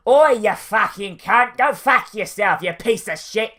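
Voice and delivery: loud, high, rough open-mouthed abuse; slow, rough British thug shout; British street tough. Acted. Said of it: "like a street tough".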